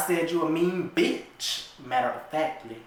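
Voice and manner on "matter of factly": usual speaking voice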